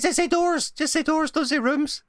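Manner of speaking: high, urgent repeated plea; insistent correction. Pretend. Player corrects the game master's wording, high and insistent.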